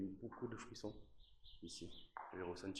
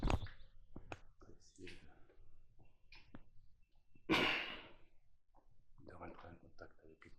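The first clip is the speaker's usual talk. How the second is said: slow, breathy whisper into the dark; slower than usual. Real, not acted. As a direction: hush it, draw each syllable out. Whispering in dark haunted stairway.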